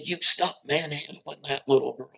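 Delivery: lightly voiced grandmother telling him off; imitating scolding grandmother. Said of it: "her grandmother came around the corner and told me".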